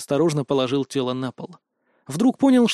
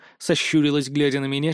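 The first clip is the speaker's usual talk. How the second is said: usual speaking voice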